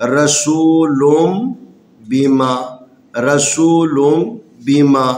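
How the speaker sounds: رسولم with the n read as m before بیما; n turned to m. Shown as a demonstration. Teacher models رسولم بیما, iqlab n to m. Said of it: "نون کی آواز کو میم کی آواز میں تبدیل".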